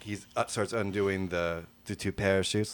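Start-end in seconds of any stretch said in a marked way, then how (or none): none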